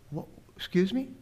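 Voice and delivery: high, taken-aback what; startled question. Retold or imitated. Russian guest startled by the young man's confession. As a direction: she can't believe what she heard. Startled woman asks him to repeat.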